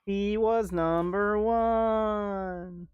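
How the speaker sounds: high, very slow drawn-out mourning; mock lament. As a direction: wail it slowly as a joke. Mock lament answering teasing.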